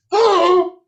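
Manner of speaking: loud high cry of surprise; a shocked "Oh!" shouted out high; surprised cry. Acted. Daughters gasp at the young man, loud high surprised cry. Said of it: "the princess and the guard's daughter went".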